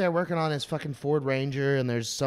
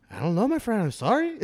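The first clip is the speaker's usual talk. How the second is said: put-on high-pitched Mexican-accented apology; 8 semitones higher than usual. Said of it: "like, an old Mexican man".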